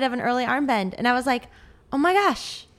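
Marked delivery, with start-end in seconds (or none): 1.9-2.6 surprised. Retold or imitated. Shocked at her own technique.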